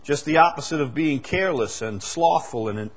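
usual speaking voice

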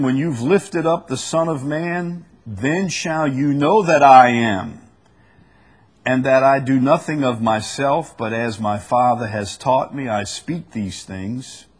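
scripture reading. Acted. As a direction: proclaim the verse, building on "I am". Reads scripture aloud.

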